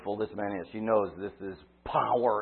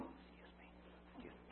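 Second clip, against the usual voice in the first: whispered, slipping back into the crowd; whispered excuse; 30 dB quieter, faster than usual. Retold or imitated. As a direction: breathe it, trying not to be noticed. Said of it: "picture her kinda going".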